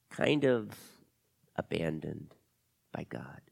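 low, halting, fading to a near-whisper; dejected admission. Acted. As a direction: confess the hurt haltingly. Camila confesses feeling abandoned.

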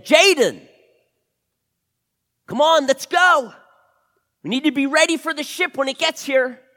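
loud, high shout; urgent calling out. Acted. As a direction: yell for your partner to hurry up. Second man calls his partner.

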